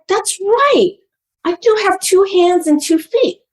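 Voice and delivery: acted-out plucky resolve; child's resolve retold. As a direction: imitate a kid convincing themself. Retells child's sudden resolve.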